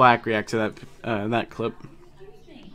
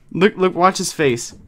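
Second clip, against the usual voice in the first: normal talking voice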